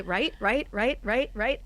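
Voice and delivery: each right lifted like a question; repeated rising right. Shown as a demonstration. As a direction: rattle off right after right, questioning. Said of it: "you wouldn't say".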